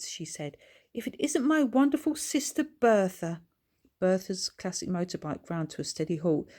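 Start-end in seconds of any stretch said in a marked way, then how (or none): 0.9-3.4 excited. A narrator's reading. Greeting her sister.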